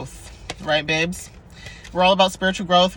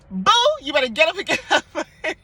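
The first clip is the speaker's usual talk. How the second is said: much higher, hammed-up bossy alarm voice; cheeky order at eight in the morning; sassy wake-up call; 10 semitones higher, 3 dB louder than usual. Alarm clock at eight a.m. waking viewers, hammed-up sass. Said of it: "you hear".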